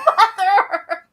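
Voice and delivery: loud, laughing mock reproach; laughing scold. Real, not acted. To her high mom ogling an actor, laughing scold. Reacting to high mom's comment.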